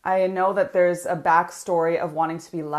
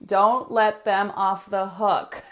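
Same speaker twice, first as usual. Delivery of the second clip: "don't let them off the hook" drawn out slowly, every word weighed; slow and deliberate; much slower than usual. Shown as a demonstration. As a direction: spell the rule out slowly so they can write it down. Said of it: "and write this down".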